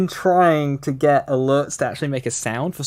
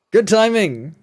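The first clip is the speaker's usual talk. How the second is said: excited shout; 9 semitones higher, 7 dB louder than usual. Real, not acted. Celebrating good timing.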